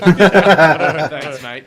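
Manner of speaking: laughing, hammy Aussie "thanks mate"; Australian accent through a laugh. Retold or imitated. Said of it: "doing an Australian accent".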